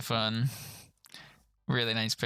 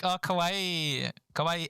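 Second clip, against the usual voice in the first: cooing kawaii; 5 semitones higher, slower than usual. Retold or imitated. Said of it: "she's like".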